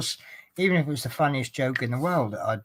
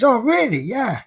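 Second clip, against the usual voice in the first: high, loud mock surprise; the doubtful hairdresser caught off guard; surprised; 9 semitones higher, 6 dB louder than usual. Negative hairdresser hears Rome was lovely, high loud surprise.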